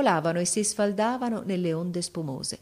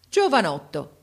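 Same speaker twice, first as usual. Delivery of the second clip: stern; 4 dB louder than usual. A narrator's reading. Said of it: "con voce severa".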